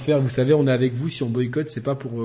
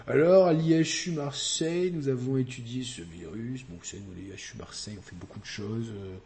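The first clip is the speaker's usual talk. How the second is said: Professor Raoult impression; 4 semitones lower, 7 dB quieter than usual. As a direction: imitate a smug Marseille professor. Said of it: "au professeur Raoult".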